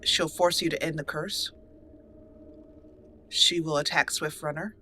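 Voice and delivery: usual speaking voice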